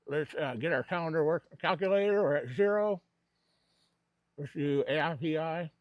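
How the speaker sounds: talking around a cigar; words muffled by a cigar; cigar in mouth. Real, not acted. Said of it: "I talk with a cigar in my mouth".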